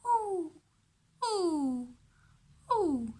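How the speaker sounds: "Ooh" rounded, long and high; ooh sound demo. Shown as a demonstration. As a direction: model the "ooh" sound for kids. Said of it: "we make this form with our mouth".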